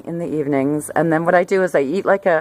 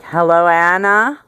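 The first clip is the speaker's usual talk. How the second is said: put-on New York accent; 4 dB louder than usual. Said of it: "one of those wonderful New York City accents".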